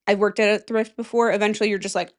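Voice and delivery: usual speaking voice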